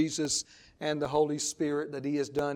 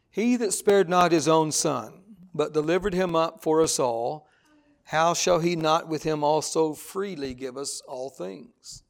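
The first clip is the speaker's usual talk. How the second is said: scripture reading; 5 dB louder than usual. Acted. Reads scripture aloud to congregation.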